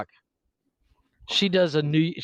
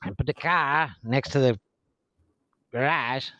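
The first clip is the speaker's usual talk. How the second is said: Boston accent; 3 semitones lower than usual. Shown as a demonstration. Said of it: "in Boston".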